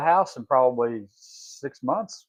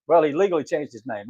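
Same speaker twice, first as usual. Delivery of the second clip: ordinary talk, nothing marked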